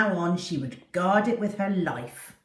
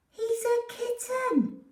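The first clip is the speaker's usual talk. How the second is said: put-on high sweet girl voice, slowly; Susie, lightly high; slower than usual. Susie showing the dog her new kitten, sweetly. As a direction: coo it like a pleased little girl. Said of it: "Susie smiled".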